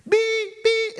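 two high-pitched mock bleeps; imitated censor beep. Teacher imitating students' cursing across campus, bleeped out. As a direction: bleep out the swearing, high and sustained. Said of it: "I heard them, hear them across campus".

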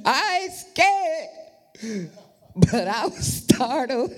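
high, slow teasing echo; mocking his excuse. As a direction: tease him with his own line. Mocking son's words.

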